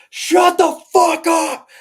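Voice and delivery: high, drawn-out full-throated yell; screaming. Retold or imitated. Brothers yelling out car windows at traffic, screaming. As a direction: scream it out at top volume, stretching each word. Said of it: "literally screaming out the freaking windows".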